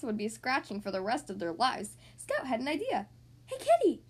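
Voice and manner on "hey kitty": high, loud, eager call; excited shout to get attention; exclaiming. A narrator's reading. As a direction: call a friend with a sudden idea. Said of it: "he exclaimed".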